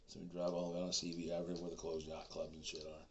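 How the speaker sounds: normal talking voice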